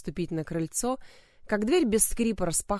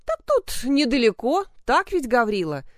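cheerful; 5 semitones higher, 6 dB louder than usual. A narrator's reading. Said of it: "весело обратился".

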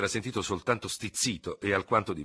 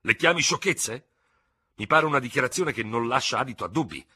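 higher, louder, affronted comeback; indignant retort; 6 semitones higher, 6 dB louder than usual. A narrator's reading. Ferraud argues with the officer who dismissed him, indignant. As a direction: throw the word back at him, offended. Acted quarrel between two officers.

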